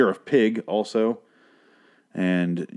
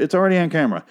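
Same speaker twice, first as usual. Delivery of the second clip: rushed, higher-pitched retort; quick exasperation; 8 semitones higher, faster than usual. Retold or imitated. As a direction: toss off ALREADY on camera quickly. Repeats the point in exasperation.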